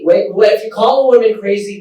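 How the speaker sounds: ordinary talk, nothing marked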